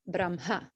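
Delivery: "Brahma" chanted form BRAM-ha, m before h. Shown as a demonstration. Teacher passing on the lineage's chanting, "Brahma" as BRAM-ha. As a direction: say "Brahma" with ma-sound closing before ha. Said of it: "the Makara before the Hakara".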